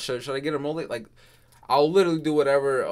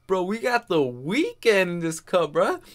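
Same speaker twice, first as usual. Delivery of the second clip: vibing to the song; hyped; 5 semitones higher than usual. Real, not acted. Hyping [name]'s verse.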